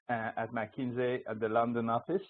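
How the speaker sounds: ordinary talk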